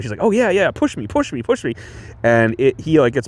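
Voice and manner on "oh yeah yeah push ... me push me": eager flirty. Retold or imitated. Retells eager old woman.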